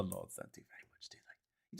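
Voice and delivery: conspiratorial whisper; whispered aside. Real, not acted. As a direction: whisper it like a secret. Aside about the Lords.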